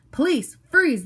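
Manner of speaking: high, loud police yell. A narrator's reading. Policeman yelling at the suspect, loud high yell. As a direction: bellow the order. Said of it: "the big policeman yelled".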